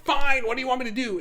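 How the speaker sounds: high, loud, fed-up retort; exasperated snap. Retold or imitated. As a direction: throw up your hands in frustration. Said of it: "going like".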